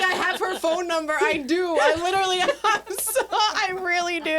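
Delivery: loud and high, laughing through it; laughing insistence. Real, not acted. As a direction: protest through giggles that it's true.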